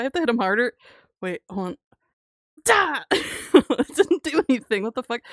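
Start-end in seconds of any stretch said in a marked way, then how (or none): 2.6-3.0 high loud "Da!" as you strike; effort grunt. Real, not acted. Streamer yells with effort on a slap. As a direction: put your whole arm into the slap.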